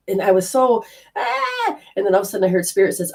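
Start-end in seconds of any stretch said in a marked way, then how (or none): 1.2-1.8 a soft high wail of panic; panicked high cry. Retold or imitated. Panicking in the car beside her husband, a soft high cry. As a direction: let out a small frantic cry. Falling apart while driving.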